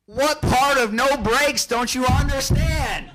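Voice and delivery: angry dad shouting. Retold or imitated. Dad on the radio blasting his son about the brakes, furious shout. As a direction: bellow it, spelling out each word in disbelief. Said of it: "my dad comes over the radio at that moment and goes".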